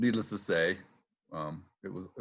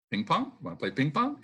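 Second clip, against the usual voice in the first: teasing; 6 semitones higher than usual. Retold or imitated. Buddies teasing him.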